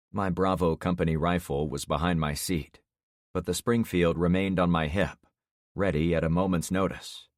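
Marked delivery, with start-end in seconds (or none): none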